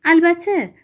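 high, loud eager exclamation; an eager husband cutting in, excited. Acted. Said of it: "آرکادای مشتاقانه گفت".

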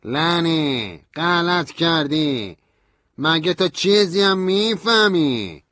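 high, very slow, loud scornful yell; angry insult. A narrator's reading. Stanley insults narrator furiously, loud slow yell. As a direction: drag out every insult.

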